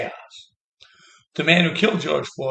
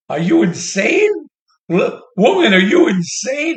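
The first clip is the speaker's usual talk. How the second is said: louder, slower, higher yelling disbelief; exasperated shout at her; 7 semitones higher, 7 dB louder, slower than usual. Acted. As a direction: shout it like you can't believe her. Ranting at Hillary directly.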